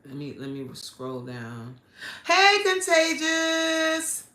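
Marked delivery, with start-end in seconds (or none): 2.3-4.2 high, loud, drawn-out hello; excited greeting. Real, not acted. Host greeting a viewer on the live, excited call. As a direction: stretch the greeting into a happy call.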